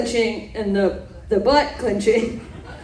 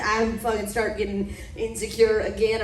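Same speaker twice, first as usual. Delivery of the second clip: ordinary talk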